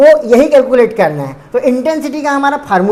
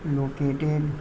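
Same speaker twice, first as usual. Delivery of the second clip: low, very soft, halting read-out; quiet word-by-word dictation in a lowered voice; slow dictation; 8 semitones lower, 18 dB quieter, much slower than usual. Real, not acted. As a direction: say it softly to yourself, word by word, with long pauses. Murmurs definition aloud, likely while writing.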